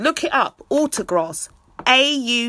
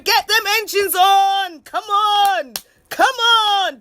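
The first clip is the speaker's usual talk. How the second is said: high, loud, drawn-out cry; hype shout; 10 semitones higher, 5 dB louder, slower than usual. Real, not acted. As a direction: rev everyone up. Hyping crowd.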